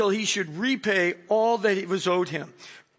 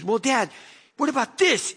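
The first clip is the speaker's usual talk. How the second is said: daughter's voice; 5 semitones higher than usual. Retold or imitated.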